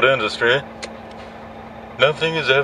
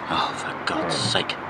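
slow, breathy whispered exasperation; exasperated whisper; 4 semitones lower, 7 dB quieter, slower than usual. Real, not acted. Trucker spotting a dirty patch on his lorry, whispered exasperation. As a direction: sigh it out in a whisper. Spots a spot on washed lorry.

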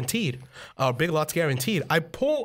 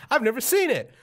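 lightly voiced excited kid; ten-year-old self; 8 semitones higher than usual. Ten-year-old answering dad about snow, eager and high. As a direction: pitch it up like an eager child. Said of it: "said ten-year-old me".

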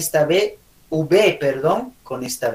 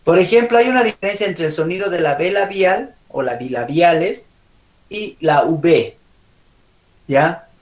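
normal talking voice

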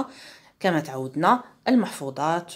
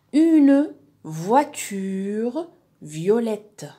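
very slow "Une voiture violette", every syllable drawn out; stretched-out model reading; 3 semitones higher, 4 dB louder, much slower than usual. Shown as a demonstration. Teacher sounding out words for pupils, stretched-out model reading.

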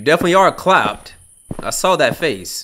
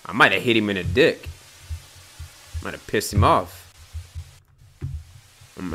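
usual speaking voice

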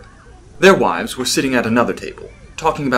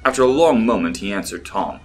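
ordinary delivery

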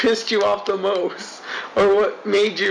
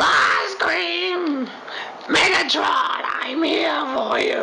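high, drawn-out mock Starscream wail; whiny, raspy voice; 8 semitones higher, much slower than usual. Co-host hammers home the Starscream comparison, in a high whiny imitation. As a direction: cry it out in a whiny, raspy impression. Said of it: "whiny, raspy voice".

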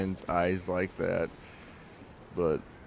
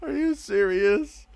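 high, drawn-out laughing squeal; cracking up in disbelief, voice way up high; helpless laughter; 3 dB louder, slower than usual. Real, not acted. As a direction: squeak the words out through real laughter. Cracks up at what was read.

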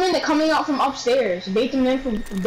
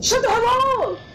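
yelled retort; 13 semitones higher than usual. Real, not acted. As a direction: shout it back at your buddy. Retort repeated, now yelled.

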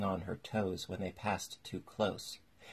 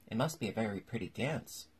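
plain reading voice

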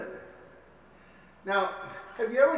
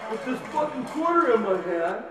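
higher and louder, building to the punchline; rising excitement; 10 semitones higher, 4 dB louder than usual. Retold or imitated. [name] building outrage at strangers handing him quarters. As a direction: wind up the absurd moment. Retold bus stop climax.

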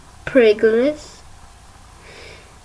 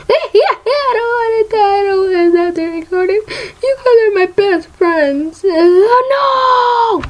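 hammed-up fake sobbing, much higher; mock crying; 8 semitones higher than usual. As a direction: wail it like a fake tantrum, pitched way up. Pretends to cry about stopping recording.

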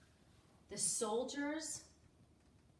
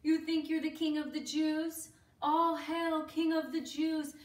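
loud, higher-pitched jeering; crowd's scornful, exaggerated jeer; mocking; 5 semitones higher, 12 dB louder than usual. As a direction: taunt him with mock reverence. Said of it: "they were making fun of him".

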